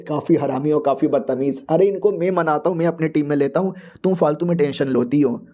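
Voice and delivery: ordinary delivery, nothing marked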